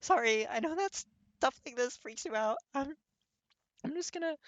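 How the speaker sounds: tearful apology. Acted. Kirishima crying apology.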